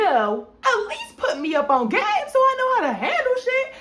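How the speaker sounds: high, loud half-laughing half-crying complaint; laugh-crying rant. Retold or imitated. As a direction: laugh through the tears of disbelief. Venting at friend.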